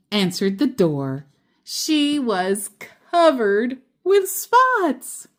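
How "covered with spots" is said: much higher, slow and drawn out; spring the surprise on the listeners; surprised reveal. A narrator's reading. Reader to children, revealing with surprise.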